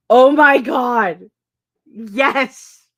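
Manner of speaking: loud, drawn-out oh my God; excited exclamation. Real, not acted. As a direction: stretch it out, thrilled. Reacting to a chat comment.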